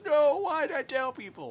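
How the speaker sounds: high-pitched put-on lament; mock wail. Podcaster mocking someone regretting his own plan, high mock wail.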